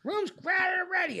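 put-on high, slow old man's complaint; imitating a cranky old man. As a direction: whine it like a stubborn old man. Old roommate voiced in story.

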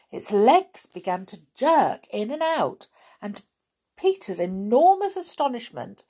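plain reading voice

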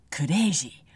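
put-on crazy in a Japanese accent. Fan quoting Sally's outburst, Japanese-accented exclamation. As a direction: blurt kureji with a Japanese accent. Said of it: "with this really strong Japanese accent".

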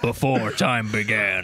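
low, slow portentous intro; hammed-up epic movie prologue; mock trailer narrator. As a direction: intone it deep and slow.

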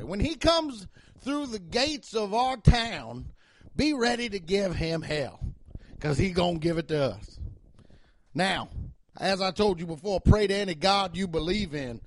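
measured, mock-solemn Southern speech; Southern cop voice. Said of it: "I do that Southern voice".

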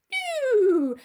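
loud, high hammed-up protest; mock panicked cry. Dorian cornered by the avenging brother, mock panic. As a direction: cry out in exaggerated alarm.

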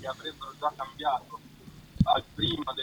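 faint, distant phone voice; speakerphone. Real, not acted. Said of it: "è a volume bassissimo lui". (in vivavoce, lontano e debole)

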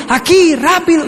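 put-on high, urgent kid's call; acted child shouting from another room; urgent yell. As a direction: holler for dad to hurry. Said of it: "está gritando".

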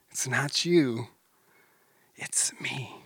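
ordinary delivery

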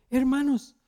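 high calling out to the group. Retold or imitated. Neighbor calling after the departing brothers, high-pitched call. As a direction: call after them from the doorway.

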